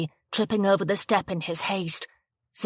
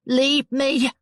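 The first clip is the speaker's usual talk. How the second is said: frightened cry; 6 semitones higher, 4 dB louder than usual. Acted. Rianne brandishes knife at Jo.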